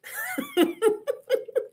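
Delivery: squealing laugh far up; laughing at her own joke; high delighted laugh. Real, not acted. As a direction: burst into a high giggle. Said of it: "Y'all know that's how we talk".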